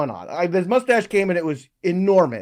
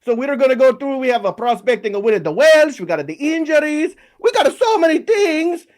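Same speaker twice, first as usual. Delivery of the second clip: a cartoon Italian plumber, big and bouncy with an Italian accent; Mario voice; 5 semitones higher than usual. Host as Mario opens the show in a mock Italian accent. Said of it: "speak in a very stereotypical voice just for the opening about an Italian plumber".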